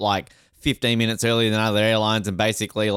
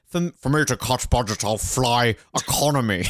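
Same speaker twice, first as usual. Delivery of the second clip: hammed-up Scottish film-star impression; put-on Scottish actor voice; Sean Connery; 2 semitones higher than usual. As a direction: play a noble thrifty star with a put-on burr. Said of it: "I just like Sean Connery going".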